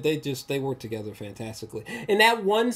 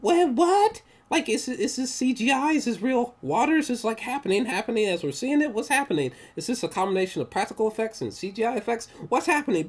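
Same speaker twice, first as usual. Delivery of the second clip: baffled amazement; 10 semitones higher than usual. Retold or imitated. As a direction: sound mind-blown and lost. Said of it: "It's like".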